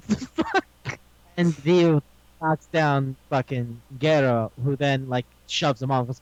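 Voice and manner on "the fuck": tiny high squeak of disbelief; very high incredulous reaction; mock bafflement. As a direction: squeak it, comically weirded out. Acted reaction to being smelled.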